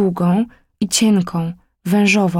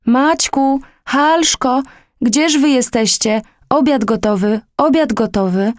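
calling out; 6 semitones higher than usual. Acted. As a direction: call the children's names from afar. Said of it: "nawoływanie z ulicy".

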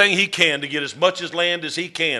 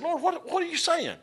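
startled question; 14 semitones higher, 6 dB quieter than usual. Retold or imitated. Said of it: "and I said".

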